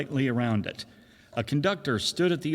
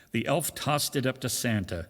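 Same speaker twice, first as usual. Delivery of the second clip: ordinary delivery, nothing marked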